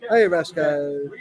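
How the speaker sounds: usual speaking voice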